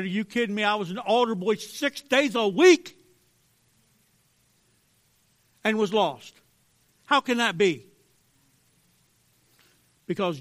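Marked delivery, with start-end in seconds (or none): 0.0-7.9 raised, indignant disbelief, louder; outraged retelling of an old anger; angry outburst. Retold or imitated. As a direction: shout it like you've just found out you were lost. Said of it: "I was so stinking angry".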